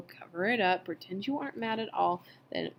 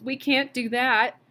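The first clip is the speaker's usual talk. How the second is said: wailing; 5 semitones higher, 5 dB louder, slower than usual. A narrator's reading. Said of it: "wailed Missus Bowles".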